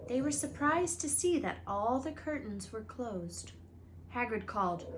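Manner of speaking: usual speaking voice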